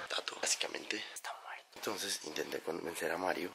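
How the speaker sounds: hushed so the friends sleep on; whispering. Real, not acted. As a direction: whisper it under the breath. Friends asleep in hotel room.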